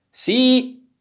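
very high, loud yell; shouted answer. A narrator's reading. Animals on the ground answering turtle, high yell. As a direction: yell it back in chorus style. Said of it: "risposero gli animali".

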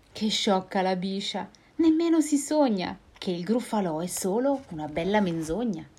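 ordinary delivery

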